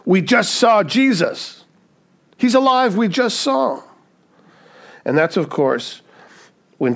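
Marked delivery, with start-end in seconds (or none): 0.0-3.8 high-pitched, thrilled proclamation; excited announcement. Retold or imitated. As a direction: burst out with amazing news. Disciples excitedly announce the risen Jesus.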